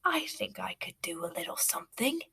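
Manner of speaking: soft, low, unvoiced whisper; a scheming little creature whispers back; sly rat whisper. A narrator's reading. The rat answers the boy in a sly whisper. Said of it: "The rat smiles".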